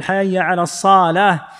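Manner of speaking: "الصلاة" drawn out slowly as "as-saalaah"; stretched word. Shown as a demonstration. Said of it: "يضبط الكلمة ولا يمدها". (مدّ «الصلاة» ببطء)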